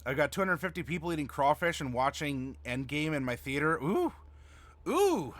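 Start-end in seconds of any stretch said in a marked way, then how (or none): 3.8-5.3 impressed ooh. Real, not acted. Viewer's crawfish party.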